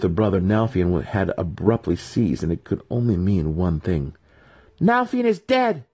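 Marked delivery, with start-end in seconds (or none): 4.8-5.8 high, loud declaration; announcing. A narrator's reading. Maya announcing her brother's death, high loud proclamation. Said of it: "she announced".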